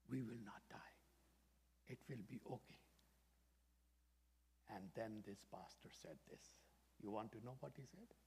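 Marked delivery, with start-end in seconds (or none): 0.1-2.8 put-on hushed child's reply. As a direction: drop to a soft, voiceless hush. Child whispering the hopeful answer.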